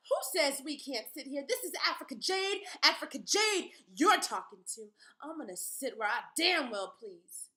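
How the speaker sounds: loud, high defiant shout; real loud. Acted. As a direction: bellow it so the whole cinema hears. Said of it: "She said that real loud".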